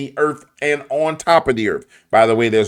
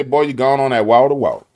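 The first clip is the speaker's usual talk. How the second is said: put-on New Orleans accent. Local saying in a New Orleans accent. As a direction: lay on the New Orleans "wahta". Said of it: "Down here in New Orleans, say".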